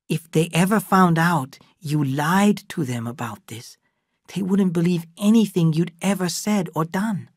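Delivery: a lightly voiced servant, earnest warning; Lee. Lee warning Adam about the lie, lightly voiced.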